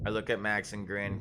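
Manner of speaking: normal talking voice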